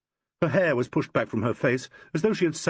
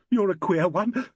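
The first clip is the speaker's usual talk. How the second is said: higher, teary, half-laughing; a touch of a sob, pitched up; sobbing; 8 semitones higher than usual. A narrator's reading. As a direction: sob it out through the tears. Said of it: "sobbed Carolyn".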